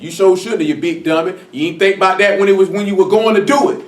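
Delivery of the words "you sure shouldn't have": ordinary talk, nothing marked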